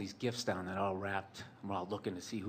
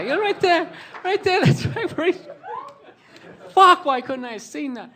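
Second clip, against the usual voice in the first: much higher and louder, cracking up; laughing hard; 15 dB louder than usual. Retold or imitated. Brothers mocking where the dad slipped, loud laughing squeal. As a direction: shriek with laughter as you point at the spot.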